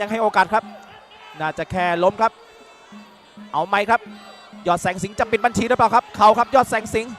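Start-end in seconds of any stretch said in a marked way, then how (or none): 4.7-7.1 rising live fight call; excited boxing commentary. Real, not acted. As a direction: build to the knockout call. Knockout moment commentary.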